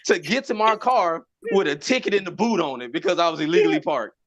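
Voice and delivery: normal talking voice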